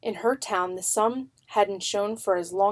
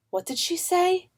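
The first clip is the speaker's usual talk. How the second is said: put-on boy voice; 9 semitones higher than usual. A boy asks.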